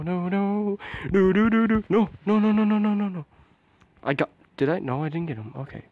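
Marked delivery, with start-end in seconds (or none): 0.0-3.2 high string of repeated nos; panicked nos. Real, not acted. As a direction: rattle off "no" in rising alarm. Panics during the game.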